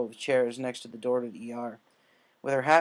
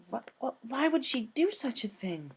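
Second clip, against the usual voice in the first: high, stuttering, horrified question; shaky stammer; 10 semitones higher than usual. Acted. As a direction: stumble out the words in shock. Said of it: "shakily asked".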